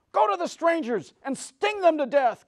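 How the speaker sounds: high, loud commanding witch. Acted. Witch sends her bees, high and loud. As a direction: spit the command like a wicked witch. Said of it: "commanded the witch".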